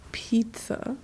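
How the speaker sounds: puzzled echo. Retold or imitated. Korean listeners failing to catch her English word, puzzled whisper. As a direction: whisper it back, baffled.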